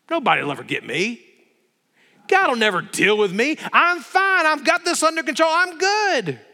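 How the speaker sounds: a smug tyrant who thinks he's untouchable; arrogant king. Acted. Preacher voicing Belshazzar sure nobody can touch him, cocky.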